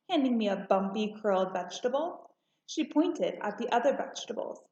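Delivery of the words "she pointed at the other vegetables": ordinary delivery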